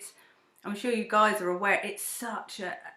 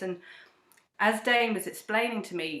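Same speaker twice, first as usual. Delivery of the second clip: ordinary talk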